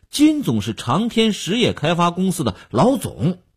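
high-pitched, built-up stress on 金总 and 老总; emphatic introduction. A narrator's reading. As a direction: give 金总 extra weight, ending prominently on 老总. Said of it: "口气上也有些微妙的加强". (抬高音调，着重介绍“金总”和“老总”)

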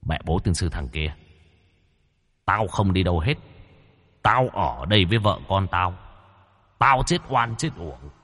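low hollow ghost voice; hollow booming voice. Acted. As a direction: speak low and hollow like a possessing spirit. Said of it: "cất giọng ồm ồm".

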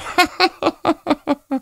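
very high helpless cackle; high shrieking laugh. A narrator's reading. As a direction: burst into hysterical laughter. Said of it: "kahkahalarla gülüyordu". (kahkahalarla tiz gülüş)

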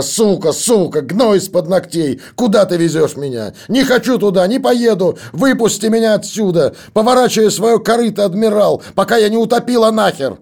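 high, loud, raving shrieks that keep climbing; hysterical screaming. A narrator's reading. Possessed daughter screams at her father on the ship, raving. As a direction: scream at him like a woman possessed. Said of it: "Последние слова она почти кричала".

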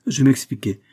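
ordinary delivery, nothing marked

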